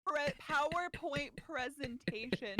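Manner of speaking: drawn out slowly; slow emphasis. Real, not acted. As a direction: stretch the words for effect.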